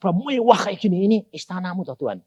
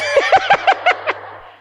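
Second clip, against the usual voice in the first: laugh; 4 dB louder than usual. Real, not acted. Laughing at his own joke.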